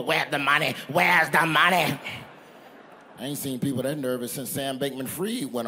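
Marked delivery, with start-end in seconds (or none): 0.0-2.0 acted panicked customer, building louder; hammed-up shout, stretched on the repeat; mock demanding yell. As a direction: pound out the demand like banging on a door. Acts out bank customers banging on door.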